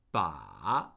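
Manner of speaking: Ba dipping very low, then rising; third tone on Ba. Shown as a demonstration. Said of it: "The falling and rising tone".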